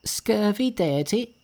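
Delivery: high, drawn-out scornful cry; an indignant monkey's high, slow insult; very angry. A narrator's reading. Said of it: "very angry".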